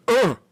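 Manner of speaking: high grunt of revulsion; disgusted ugh. Retold or imitated. She walks in on the mess, disgusted grunt. Acting her walk into bathroom.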